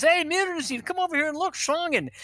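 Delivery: high-pitched imitation of an officer calling buddies; excited calling out. German officer to his buddies about the snakes, excited mock calling. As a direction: call your pals over, pitched well up.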